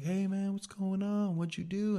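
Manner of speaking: cheery high greeting of a gentle big man; sweet big guy. Retold or imitated. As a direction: nurse greets the patient in a high friendly voice. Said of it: "really sweet".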